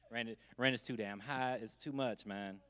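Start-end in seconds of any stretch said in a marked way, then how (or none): 0.1-0.3 falsetto squeak. Retold or imitated. Rent too damn high.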